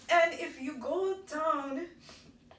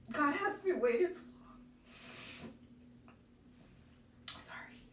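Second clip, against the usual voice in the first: choked up with emotion; tears breaking through; happy crying; 2 semitones higher, 3 dB quieter than usual. Real, not acted. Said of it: "how I'm crying".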